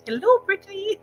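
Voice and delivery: calling out a bright, high hello; high excited greeting. Real, not acted. Greeting Brittany in the chat, high sing-song hello.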